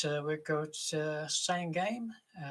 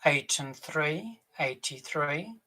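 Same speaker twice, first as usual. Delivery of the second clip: loud, drawn-out bingo calls; bingo caller; 9 dB louder, slower than usual. Acted. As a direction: call the numbers across the hall. Bingo caller calling numbers.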